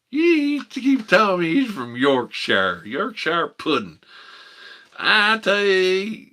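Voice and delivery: high, loud, slow Appalachian drawl; hillbilly accent. Retold or imitated. Mocking the caller's origins in a loud, high Appalachian accent. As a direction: ham up a West Virginia yokel. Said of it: "they actually talk like that".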